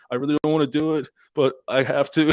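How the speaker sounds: high, loud mock apology from the big guy; mock reluctant brute. Retelling Mongo sorry to fight the medic, high mock plea. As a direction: play the sorry bully.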